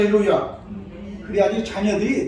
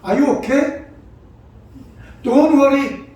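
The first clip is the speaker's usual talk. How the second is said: reassuring American parent; 4 semitones higher, 5 dB louder, much slower than usual. Retold or imitated. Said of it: "자식들이 뭘 잘못하고".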